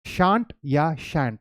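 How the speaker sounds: shan't या shan't, first with a broad SHAHNT, then a flat SHANT, very slow; shan't या shan't drawled apart to set the two vowels side by side; both pronunciations of shan't. Shown as a demonstration. Teacher contrasts two ways of saying shan't for students. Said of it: "तो दो pronunciation है आपको".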